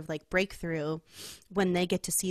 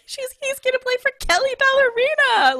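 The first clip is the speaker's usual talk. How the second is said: high, loud, hammed-up proud shout; mock-booming announcement, pitched way up; proud dad; 10 dB louder than usual. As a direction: imitate a dad bellowing his boast to the room. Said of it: "he's just so proud".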